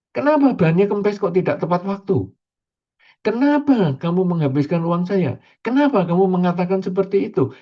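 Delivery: high, insistent complaining kenapa; exasperated why. Retold or imitated. A preacher mimicking an angry person's complaints. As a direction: whine at the world, demanding why.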